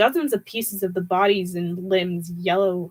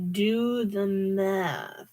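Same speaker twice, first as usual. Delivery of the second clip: very slow, softer scornful rasp; slow soft sneering rasp; raspy teacher voice; 5 dB quieter, much slower than usual. A narrator's reading. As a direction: drag out the command like a bored teacher. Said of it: "the voice said".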